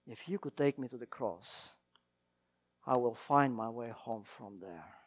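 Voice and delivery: hushed repeat. Acted. Preacher repeating the girl's words softly to the congregation, hushed. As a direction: let it sink in, barely above a breath. Sermon climax, repeated line.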